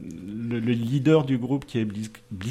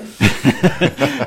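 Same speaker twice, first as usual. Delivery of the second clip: a burst of surprised laughter, a bit louder; embarrassed laugh; 7 dB louder than usual. Real, not acted. Columnist caught off guard by the host's question, laughing.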